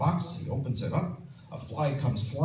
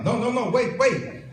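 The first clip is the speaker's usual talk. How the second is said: urgent high no no no, wait; frantic high-pitched halt; excited stop; 9 semitones higher than usual. Acted. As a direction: rush to hold her back, pitched up. Husband stopping wife mid-act.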